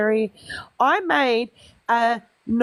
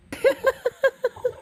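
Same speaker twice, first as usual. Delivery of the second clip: high giggle; 12 semitones higher than usual. Real, not acted. Laugh while calling cat.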